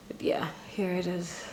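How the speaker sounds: soft unvoiced aside; hushed aside to camera; whisper. Real, not acted. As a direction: breathe it out quietly.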